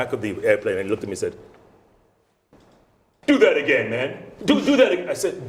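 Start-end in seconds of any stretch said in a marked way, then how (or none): 3.3-5.1 higher and louder, amazed; excited instructor. Retold or imitated. Instructor at the back of the plane, excited and louder. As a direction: call out like you just heard a star.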